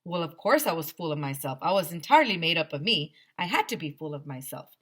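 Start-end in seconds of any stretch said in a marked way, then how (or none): none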